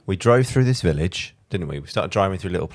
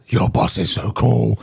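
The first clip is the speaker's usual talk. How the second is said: loud, slow, gushing fan; excited trainee; 2 semitones higher, 6 dB louder, slower than usual. Retold or imitated. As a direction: gush over the bus like a kid. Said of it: "looked at us and went".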